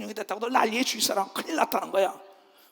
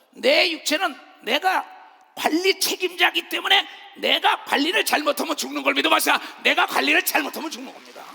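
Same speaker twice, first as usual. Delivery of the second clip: full-force preaching; 4 semitones higher, 4 dB louder than usual. Acted. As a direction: hammer the warning home.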